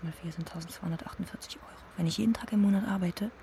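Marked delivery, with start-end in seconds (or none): 0.0-1.8 fast whispered sums; muttering sums in a rushed whisper; whispered counting. Acted. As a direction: rattle off the numbers under your breath, quickly. Counting money under her breath.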